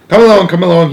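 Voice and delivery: brisk loud call to hurry. A narrator's reading. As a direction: call everyone to come along. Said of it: "he called out".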